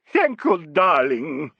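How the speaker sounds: bestow thanks like a prima donna; imperious, lavish endearment; grand diva. A narrator's reading. Diva grandly summons young admirer.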